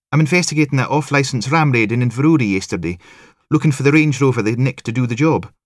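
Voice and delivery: high, loud, quick Highland patter; eager Highland officer introducing herself; Highland accent. A narrator's reading. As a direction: rattle off your credentials in a sing-song Highland lilt. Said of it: "it explained the accent".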